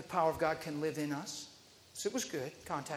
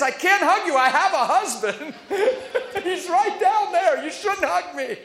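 higher and louder, giggling through the protest; laughing refusal; 9 semitones higher, 8 dB louder than usual. Retold or imitated. Lady giggles that her husband is right there, laughing. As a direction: refuse him while laughing at the idea.

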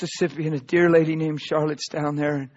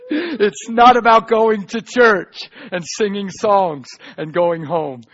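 normal talking voice

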